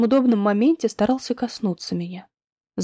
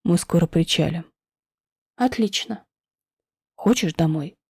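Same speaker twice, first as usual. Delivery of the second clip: low husband voice; 6 semitones lower than usual. Acted.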